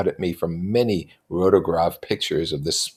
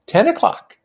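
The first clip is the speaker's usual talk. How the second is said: put-on higher young woman voice; Jordan voice; 7 dB louder than usual. A narrator's reading. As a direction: lift into a light high voice. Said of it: "she remarked".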